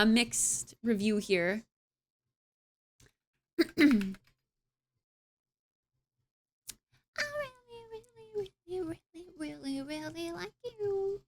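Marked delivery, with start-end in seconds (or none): 3.6-11.2 high, soft attempted Irish accent, hushed; a shaky go at an Irish lilt, breathy and high. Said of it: "Totally Irish".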